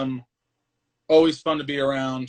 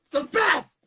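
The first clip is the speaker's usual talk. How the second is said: gushing; 7 semitones higher, slower than usual. Real, not acted. Gushing about flip-outs.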